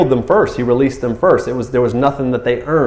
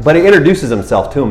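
normal talking voice

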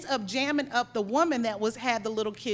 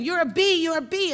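higher, louder mock yell; retold screaming; 5 semitones higher, 4 dB louder than usual. Angry woman shouts back at the manager, retold screaming. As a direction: throw the insult like an angry shopper. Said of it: "they just kept screaming".

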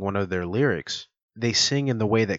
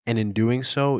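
normal talking voice

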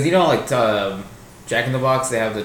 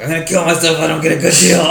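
mock desperate threat; 5 semitones higher, 4 dB louder, faster than usual. Acted dramatic threat quote.

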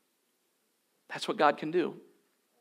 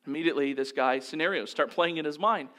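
ordinary delivery

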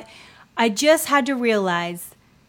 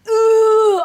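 high ooh; 12 semitones higher, 8 dB louder than usual. Real, not acted.

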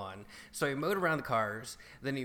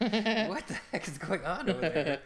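laughing disbelief; 6 semitones higher, 3 dB louder than usual. Retold or imitated. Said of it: "I'm like".

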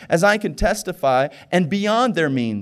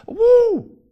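high, loud whoop; excited whoop; 9 dB louder than usual. Real, not acted. Preacher cheering mid-sermon, a loud high whoop. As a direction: let out a joyful woo.

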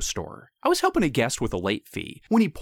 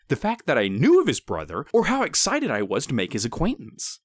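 usual speaking voice

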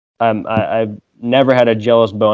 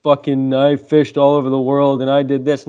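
higher, lightly mocking take on his proud dad; imitating dad; 4 semitones higher, 3 dB louder than usual. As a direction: play the boastful old-timer dad, pitched up. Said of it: "and he's like".

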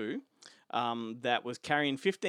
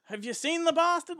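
hammed-up New Zealand hunter shouting; mock Kiwi bushman accent; 8 semitones higher, 10 dB louder than usual. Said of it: "bust out that accent again".

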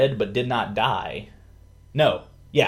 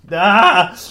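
loud, high "aah"; frustrated yell; 10 semitones higher, 11 dB louder than usual. Real, not acted. Storyteller flustered by the time limit, frustrated yell. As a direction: cut yourself off with a yell. Breaking off own blah-blah summary.

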